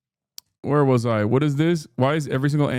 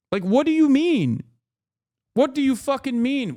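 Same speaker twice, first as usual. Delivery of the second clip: much higher, exasperated demand; incredulous anger; 10 semitones higher than usual. Real, not acted. To chat, scoffing at the critic, high exasperated demand. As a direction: throw the question back in disbelief.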